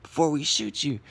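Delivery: high-pitched mock yell, no real volume; imitated yelling. Friend's mom chasing them off the property, imitated yelling. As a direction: imitate an angry mom threatening intruders in a high voice. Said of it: "starts yelling at him".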